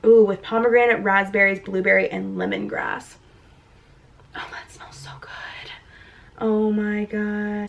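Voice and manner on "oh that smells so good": breathy whisper. Real, not acted. Smelling the tea.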